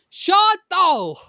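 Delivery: loud, high call of Shoto; shouting a name. Acted. Calling out to Shoto, loud and high. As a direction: yell the name across the room.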